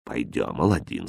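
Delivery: low, soft, firm order; quietly severe uncle; stern. A narrator's reading. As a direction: summon the boy without raising your voice. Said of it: "строго сказал Маграб".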